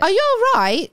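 high-pitched British greeting; British greeting imitation. Quoting what she says to everybody, high British accent. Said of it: "She still says that to everybody".

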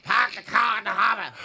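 loud, exaggerated Boston accent; goose-like Boston yell. Shown as a demonstration. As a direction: honk it like a mock Bostonian. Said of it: "That sounded like a goose that learned English".

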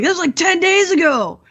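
a mock freak-out; alarmed. Family panicking over ten days.

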